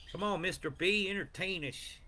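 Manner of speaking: high, teasing call to perform; mock taunting commenter. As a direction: egg him on in a high put-on voice. Reading a viewer's comment aloud.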